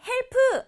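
헬프 said in two beats with an added final vowel; wrong two-beat pronunciation. Shown as a demonstration. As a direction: say 헬프 as two Korean syllables, hel-peu. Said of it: "이렇게 두 박자 아니고".

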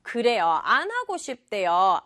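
usual speaking voice

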